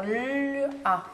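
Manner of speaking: "le A" with a long held L before the A; L sound held. Shown as a demonstration. Teacher showing the sound of L, "le A" with the L stretched. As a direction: hum out the L in "le", then release into "A". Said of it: "Quel bruit fait le L?".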